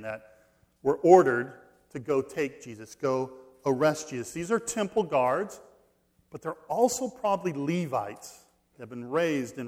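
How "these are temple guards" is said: usual speaking voice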